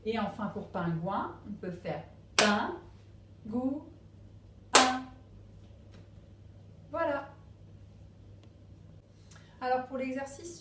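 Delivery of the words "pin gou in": PIN, GOÛT, UN as isolated beats, UN much louder; long pauses between PIN, GOÛT and a punched UN; syllable by syllable. Shown as a demonstration. Teacher clapping on the sound, PIN, GOÛT, UN in separate syllables. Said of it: "on tape dans les mains".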